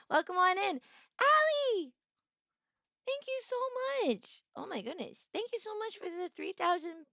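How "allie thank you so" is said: delighted squeal. Real, not acted. Greeting a viewer, delighted squeal. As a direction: squeal the name much higher.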